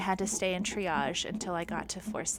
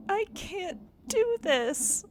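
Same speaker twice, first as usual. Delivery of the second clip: desperate plea; 13 semitones higher, slower than usual. Retold or imitated. Begging husband mid-labor.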